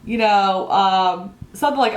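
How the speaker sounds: ordinary talk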